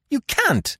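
very high, loud protest; desperate high-pitched cry. A narrator's reading. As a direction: cry out in refusal. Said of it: "she cried".

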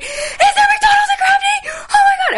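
high, loud, laughing squeal of delight; ecstatic shout. Retold or imitated. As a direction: shriek it with pure giddy joy. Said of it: "And I was like".

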